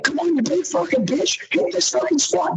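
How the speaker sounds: high, pumped yelling at a lifter; hype cheer. Retold or imitated. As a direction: fire up your friend under the bar.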